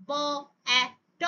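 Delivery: each sound apart, with pauses between; sounds said separately. Shown as a demonstration. Teacher shows the separated sounds before blending them. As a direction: split the word into separate sounds. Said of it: "instead of saying".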